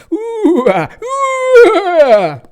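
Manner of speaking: very high, very loud spooky wail; hammed-up ghost howl; ghostly bellow. A narrator's reading. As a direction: scream to frighten her. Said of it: "started bellowing at the top of his voice".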